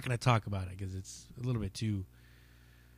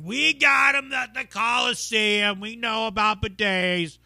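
high, loud and slow mock heckler; loud higher put-on heckler voice; heckler's boast; 11 semitones higher, 9 dB louder, slower than usual. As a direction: blurt it out like a proud know-it-all fan. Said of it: "he's like".

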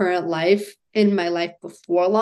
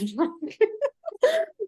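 squeaky breathless laugh, much higher; 5 dB quieter than usual. Real, not acted. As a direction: crack up at the joke. Laughing after sister's joke.

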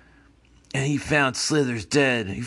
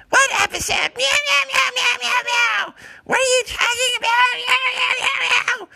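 very high, loud cat screech; high loud catlike meowing; shrieking cat voice; 6 dB louder, faster than usual. Acted. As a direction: yowl like a deranged cat doctor. Cat character shrieking back.

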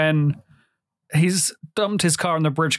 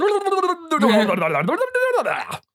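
long, very high maniacal laugh; manic cackle; 12 semitones higher than usual. Retold or imitated. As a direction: cackle wildly like a cartoon madman. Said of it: "He goes".

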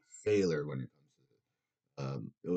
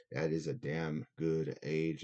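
usual speaking voice

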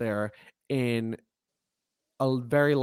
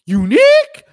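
high, loud squeak of disbelief; incredulous; 16 dB louder than usual. Real, not acted. Host doubting her own word, loud and squeaky.

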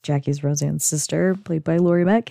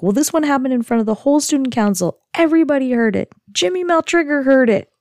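put-on high-pitched, dramatic teenage wail; mock distraught complaint; mortified teen outburst; 8 semitones higher, much faster than usual. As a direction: act out an embarrassed teen, pitch climbing with each line. Retold mortified teen complaining to mom.

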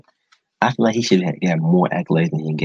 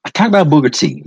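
normal talking voice

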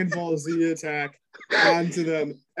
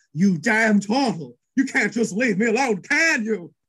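higher, louder snarl at the turtle; furious bride; 7 semitones higher, 5 dB louder than usual. Acted. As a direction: spit it at him, knife raised. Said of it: "she points her knife at him and goes".

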